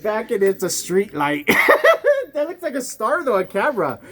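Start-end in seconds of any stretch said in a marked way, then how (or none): 1.5-2.3 very high, loud squealing laugh; high shrieking laugh. Real, not acted. To his friend over the street light, a real laugh. 2.3-4.0 laughing. Real, not acted. Still laughing about star.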